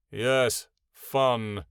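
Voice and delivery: forced, reluctant agreement; unenthusiastic, words set apart. A narrator's reading. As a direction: agree with no real enthusiasm. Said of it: "Ionin answered unenthusiastically".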